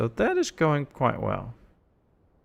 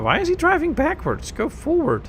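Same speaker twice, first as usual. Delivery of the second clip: much higher, incredulous; 4 dB louder than usual. Real, not acted. Streamer yelling at a taxi going backwards, incredulous. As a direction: plead with the car in disbelief.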